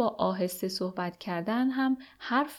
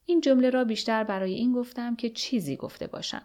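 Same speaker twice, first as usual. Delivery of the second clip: ordinary delivery, nothing marked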